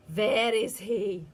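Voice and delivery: loud, slow witch voice; searching witch. A narrator's reading. Witch searching the stage, slow and loud. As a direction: peer about like a witch hunting.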